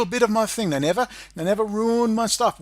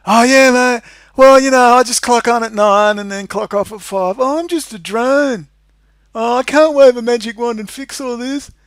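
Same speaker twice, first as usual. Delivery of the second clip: loud, higher mock voice of a useless officer; mocking drunken cop; 4 semitones higher, 8 dB louder than usual. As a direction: mimic a careless clock-watcher, loudly. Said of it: "They're like alcoholics".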